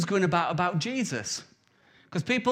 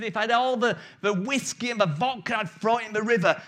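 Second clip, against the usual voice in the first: excited preacher; 4 semitones higher than usual. Retold or imitated. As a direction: build to the peak of the sermon, spitting mad. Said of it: "he's getting really excited".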